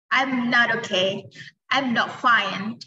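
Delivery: slow, clear teacher model of each phrase. Shown as a demonstration. Teacher modelling sad replies slowly for students. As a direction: spell the phrases out slowly for learners. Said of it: "bisa juga bilang".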